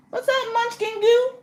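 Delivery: squeaky high-pitched hello to a viewer; high greeting voice. Acted. Streamer greeting a chat viewer, squeaky high voice. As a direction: chirp the viewer's name way up high.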